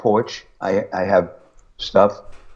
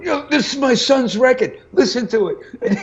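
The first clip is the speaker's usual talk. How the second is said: proud mother; 12 semitones higher than usual. Retold or imitated. Proud mother showing off record.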